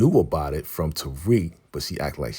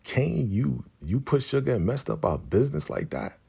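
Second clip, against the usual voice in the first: higher-pitched mock indignant questioning; retold disbelief; 7 semitones higher than usual. Someone confronts Kane about the sabotage, incredulous. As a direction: act out an incredulous accusation, pitched up.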